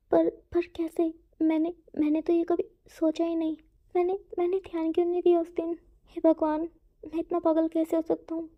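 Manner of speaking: spiral into panic, blaming yourself; panicked self-blame. Acted. Wing panics, blames himself. (घबराकर, ऊँची और तेज़ अटकती आवाज़ में)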